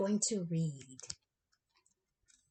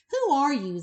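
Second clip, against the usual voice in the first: wild ducks' challenge; 8 semitones higher, 9 dB louder than usual. Acted.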